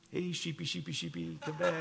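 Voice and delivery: high coaxing sing-song call; calling the sheep. Retold or imitated. Pastor calling scared sheep in a field, high coaxing call. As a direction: coax the sheep back in a high voice.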